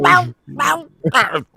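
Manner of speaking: high, loud yappy dog bark; loud shrill bark. Retold or imitated. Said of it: "it is the loudest shrill bark".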